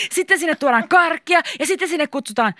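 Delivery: high, excited explaining; eager. A narrator's reading. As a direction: gush the plan out eagerly. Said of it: "Liisa selitti innokkaasti".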